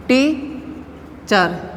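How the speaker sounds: "teacher" broken as tea... cher, slowly; split into syllables. Shown as a demonstration. Teacher showing students a two-syllable word, split into syllables. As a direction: say "teacher" with a clear gap between syllables. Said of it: "Two syllably word is teacher".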